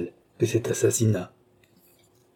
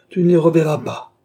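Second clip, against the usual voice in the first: loud, high, vengeful declaration; cruel threat; 8 semitones higher, 7 dB louder than usual. A narrator's reading. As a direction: drive the threat home forcefully. Villain finishes his cruel threat. (menace cruelle lancée avec force)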